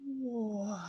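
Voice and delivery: normal talking voice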